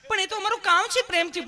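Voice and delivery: hammed-up tender nurse; loving nurse. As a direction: plead sweetly in a put-on high voice. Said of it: "પ્રેમથી પૂછો છો".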